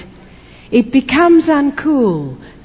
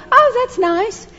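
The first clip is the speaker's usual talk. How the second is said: polite indifference; 11 semitones higher, slower than usual. Retold or imitated. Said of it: "they look at you and go".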